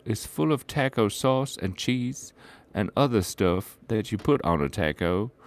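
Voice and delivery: ordinary talk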